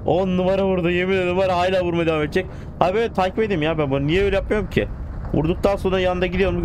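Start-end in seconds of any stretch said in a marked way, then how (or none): 0.1-2.5 high, fast thrilled commentary; excited. Real, not acted. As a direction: gush over the crash. Excited by train crash.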